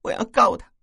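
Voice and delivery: 高声带哭腔; 委屈激动地哭喊，音调很高; 哭着说. A narrator's reading. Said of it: "那女孩哭着说". (哭着喊)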